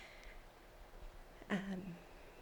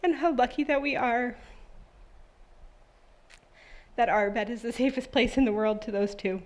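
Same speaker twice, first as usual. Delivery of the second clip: moved to tears, pushing on after long pauses; choked up; 4 semitones higher than usual. Real, not acted. Mom moved by love for her two boys, choked up. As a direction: voice lifting high as tears threaten. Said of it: "gonna pull myself together".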